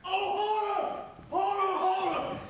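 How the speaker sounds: shrill cries of horror; horrified cry. Acted. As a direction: cry out in shock at a murder. Macduff finds the murdered king.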